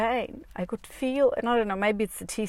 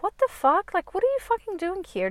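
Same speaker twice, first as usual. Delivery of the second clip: high-pitched mock indignation; retold incredulous outburst; indignant retort; 11 semitones higher than usual. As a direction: snap back at a commenter in a raised pitch. Acted retort to online commenters.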